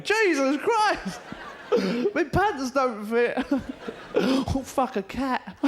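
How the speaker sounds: very high, loud, slow dismay; shocked waking. Acted. Man waking up transformed into a rat, high loud shocked cries.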